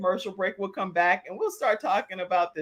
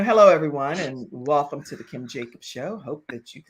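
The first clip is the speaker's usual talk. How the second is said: hushed, low-pitched distinguished host; 5 semitones lower than usual. Shown as a demonstration. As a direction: open the show smooth and refined. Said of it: "that's what I thought in my head".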